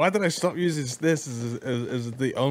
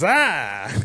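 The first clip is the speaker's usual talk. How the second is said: louder hammed-up "ah" of pain; mock dying cry; 3 semitones higher, 5 dB louder than usual. Shot enemy as player imagines him, mock cry. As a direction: cry out like an overacted Italian victim. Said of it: "I don't know why they're Italian".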